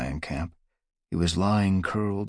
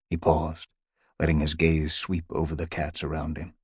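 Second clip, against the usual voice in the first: ordinary delivery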